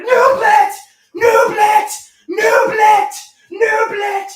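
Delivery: high, loud chanted shouts; dance shout. Retold or imitated. Vlogger's poolside dance call, shouted high and loud. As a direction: shout the dance name over and over. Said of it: "I go like".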